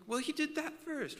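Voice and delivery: put-on high defensive child voice; lightly voiced kid blaming; mock kid retort; kid arguing back. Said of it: "The other one's like".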